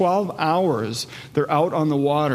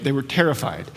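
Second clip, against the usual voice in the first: ordinary delivery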